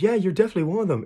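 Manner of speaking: high, fast accuser voice; bystander accusing. Retold or imitated. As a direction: blurt it as a pointing onlooker, high and quick. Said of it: "And then somebody else goes".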